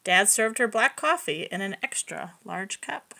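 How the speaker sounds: ordinary delivery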